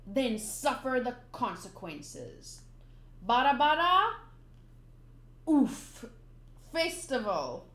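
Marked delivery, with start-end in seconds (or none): 3.2-6.0 loud attack call. Acted. Hammy manga read-aloud, villain's attack.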